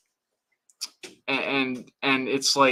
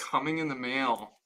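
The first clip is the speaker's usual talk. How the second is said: ordinary delivery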